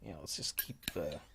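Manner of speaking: tense whisper. Real, not acted. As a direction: whisper it nervously. Tense horror game moment.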